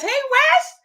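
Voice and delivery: very high, loud squeal of a name; excited high-pitched hello; squeaky high greeting. Real, not acted. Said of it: "second greeting squeaked high".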